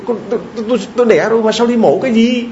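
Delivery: high-pitched, stammering mock protest; distressed protest. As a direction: stumble over the words in high-pitched disbelief. Mother protests being sent to surgery.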